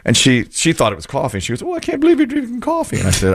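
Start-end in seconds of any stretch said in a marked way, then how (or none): 1.6-3.0 high-pitched mock mother, surprised; mother's voice. Mother at rest stop mistaking hot chocolate, high imitated voice. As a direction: squeak it up like Mom catching you out. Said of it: "She talked like that".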